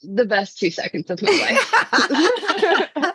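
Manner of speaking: slow, laughing gush. Real, not acted. Fan gushing to the host about the photo, slow and laughing. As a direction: savor it through a laugh.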